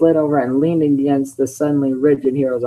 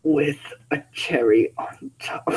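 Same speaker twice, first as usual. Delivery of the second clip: a hint of a grudging, slow Shadow voice; 4 dB quieter than usual. Shadow grudgingly finishing the plea, slow. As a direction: drag the words out reluctantly. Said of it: "maybe I shouldn't do that in the voice".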